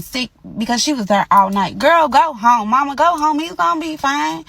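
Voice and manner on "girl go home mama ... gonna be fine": urging mama home. Retold or imitated. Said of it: "That's what I had to tell her".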